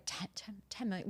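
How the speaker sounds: soft, stunned stammer; speechless. Retold or imitated. Client stunned by coach's figure, speechless. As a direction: stumble over the figure in disbelief. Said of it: "she just left me speechless".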